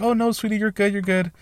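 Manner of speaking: high-pitched mock comforting voice; imitated sweet receptionist. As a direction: coo it like a kindly receptionist. Voices receptionist reassuring him.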